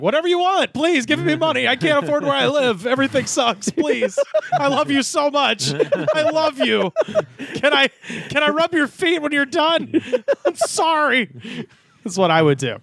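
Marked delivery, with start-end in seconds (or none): 0.0-11.3 much higher, pleading waiter; desperate begging waiter. Retold or imitated. Broke waiter begging customers for money, high pleading. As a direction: grovel for a tip. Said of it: "I'd be like".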